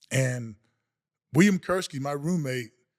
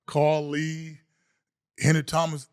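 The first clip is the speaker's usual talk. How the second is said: usual speaking voice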